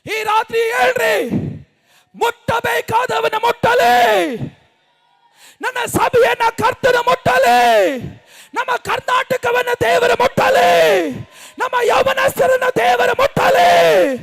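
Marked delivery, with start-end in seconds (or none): 2.1-14.1 high, forceful calls, each phrase set apart by a pause; shouted prayer declarations. Real, not acted. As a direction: lead the congregation in fervent declarations. Leads congregation in shouted declarations. (ಆವೇಶದ ಪ್ರಾರ್ಥನಾ ಘೋಷಣೆ)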